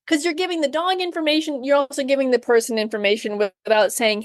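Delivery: normal talking voice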